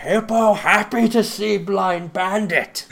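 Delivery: badly faked dopey hippo voice. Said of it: "That wasn't really a good Hippo voice".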